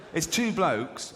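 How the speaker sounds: ordinary talk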